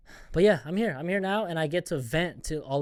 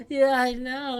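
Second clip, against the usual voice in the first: fake agreement; 9 semitones higher, 6 dB louder, slower than usual.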